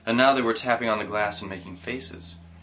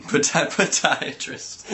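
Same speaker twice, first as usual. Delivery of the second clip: giggling as the word trips up; laughing through a word; 6 semitones higher, slower than usual. Real, not acted. Reader cracking up over a hard word, giggling. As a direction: laugh while fumbling the word.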